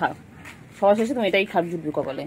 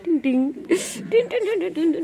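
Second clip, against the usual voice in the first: high sing-song teasing; 10 semitones higher than usual. Real, not acted. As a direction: chirp it up much higher. (উঁচু সুরে খেলার ছলে টিং টিং)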